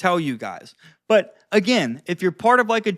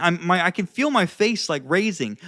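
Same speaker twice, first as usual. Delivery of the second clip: ordinary delivery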